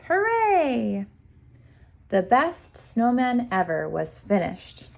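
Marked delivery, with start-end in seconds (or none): 0.1-1.1 high joyful cheer. A narrator's reading. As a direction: cheer for the finished snowman. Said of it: "Otter laughed and cheered".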